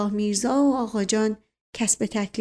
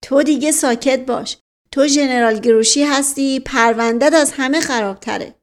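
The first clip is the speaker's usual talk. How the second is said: loud, higher angry rebuke; shouting; 3 semitones higher, 6 dB louder than usual. A narrator's reading. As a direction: shout him down indignantly. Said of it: "اسدالله میرزا فریاد زد". (با فریاد و پرخاش)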